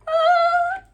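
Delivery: high, loud gasp; dramatic intake of shock. Acted. Storyteller reacting to side dude's reply, big high gasp.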